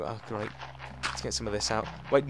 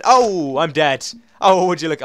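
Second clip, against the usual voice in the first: startled outburst; 5 semitones higher, 11 dB louder than usual. Real, not acted. Sudden death in the game.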